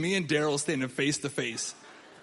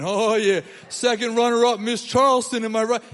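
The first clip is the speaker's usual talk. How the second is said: loud, higher brag about his date; bragging; 5 semitones higher, 8 dB louder than usual. Retold or imitated. Comic bragging to the crowd about his Charleston date, loud and boastful. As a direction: show off the second runner-up, loud and proud.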